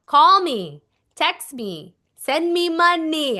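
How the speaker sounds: loud insistent command, money slow; a bossy whisper command. Shown as a demonstration. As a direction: give the order, no asking. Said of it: "give a command".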